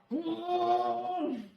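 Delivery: high, held hum; drawn-out "mm". Retold or imitated. Sound acting out a reaction.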